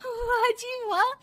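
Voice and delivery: high, drawn-out delight; gleeful. Acted. Daughter-in-law finding the game in her hands, gleeful. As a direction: gloat with a long wah.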